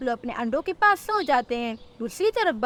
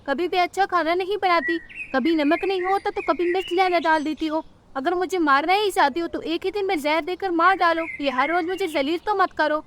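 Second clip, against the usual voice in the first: higher-pitched complaining mother-in-law; scolding mother-in-law; 5 semitones higher than usual. Acted. Mother-in-law faults Rano's cooking, scolding. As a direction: nag her about the cooking, pitched up.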